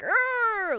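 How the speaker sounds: high-pitched imitated movie groan of pain; mock labour cry. Mimicking women giving birth in the movies, mock high cry. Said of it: "like the movies and you see and they're like".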